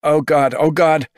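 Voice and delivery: high, loud and rushing; a field reporter losing his composure; breathless live report as people flee; alarmed reporter. A narrator's reading. Reporter panics at bomb scene.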